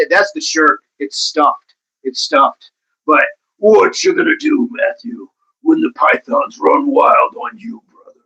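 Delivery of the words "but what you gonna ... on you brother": wrestler impression. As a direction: deliver it like a wrestler's showboating challenge. Hammed-up wrestler catchphrase to Matthew.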